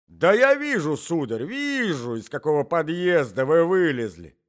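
loud, emphatic retort; indignant scolding. A narrator's reading. Old husband mocking intruder's excuse, indignant scolding. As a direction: start high and loud, stretch the repeat.

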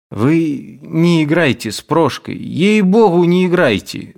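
high, pleading with a threat; anxious warning with a hint of threat; с беспокойством. A narrator's reading. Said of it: "сказал он с беспокойством и почти с угрозой". (тревожно, почти с угрозой)

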